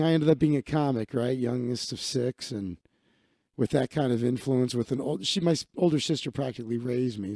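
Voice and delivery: normal talking voice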